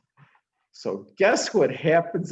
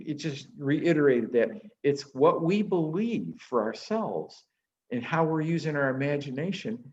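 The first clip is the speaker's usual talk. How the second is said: normal talking voice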